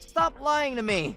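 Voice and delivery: angry accusation. Acted. Husband snapping at his cheating wife, angry and slow. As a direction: stop her lie cold.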